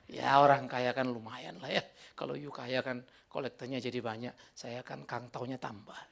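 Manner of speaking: slow, low, tongue-in-cheek aside; mock-greedy confession. Preacher joking that rich members fatten his income, mock-greedy confession. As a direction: drop low and draw it out as a joke. Jokingly plays a money-loving pastor.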